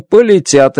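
ordinary talk

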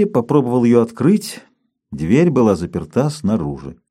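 usual speaking voice

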